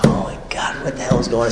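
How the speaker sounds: soft breathy whisper of alarm; panicked whisper. Retold or imitated. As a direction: whisper it like he shouldn't hear.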